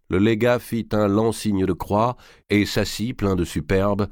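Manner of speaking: plain reading voice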